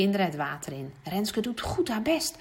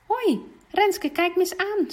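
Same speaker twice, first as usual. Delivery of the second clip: much higher, gentle and encouraging; coaxing teacher; 11 semitones higher, 3 dB louder than usual. Acted. Juf Ellen coaxing the shy girl, gentle. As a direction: coax her to look up.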